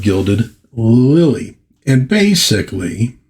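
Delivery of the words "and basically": usual speaking voice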